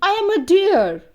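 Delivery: a hint of a lively deer introducing itself; deer character. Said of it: "Deer replies".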